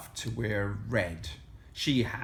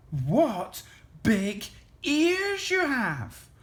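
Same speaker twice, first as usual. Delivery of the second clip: put-on slow, high girl's wonder; said slowly; 12 semitones higher, slower than usual. A narrator's reading. Little Red staring at Granny's ears, slow high voice. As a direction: draw each word out, pitched high. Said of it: "she said slowly".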